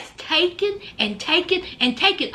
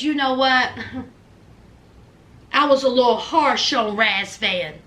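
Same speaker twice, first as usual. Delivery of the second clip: low-pitched apologetic admission; mimicked contrition; 6 semitones lower than usual. Amanda admitting she was harsh on Razvan, mimicked contrition. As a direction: imitate her owning up, pitched low.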